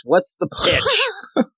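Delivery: high-pitched, drawn-out last word; hammed-up Robin. Robin answering the kids' SOS, hammed-up high voice. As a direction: stretch 'pitch' out high and silly. Hammed-up superhero line, repeated for laughs.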